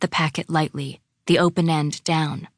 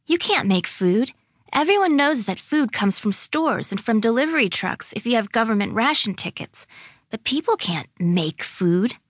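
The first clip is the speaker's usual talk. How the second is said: put-on higher-pitched child explaining the obvious; doubting girl, incredulous; 5 semitones higher than usual. Lily tells Claire food comes from stores, incredulous. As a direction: argue like a disbelieving little girl.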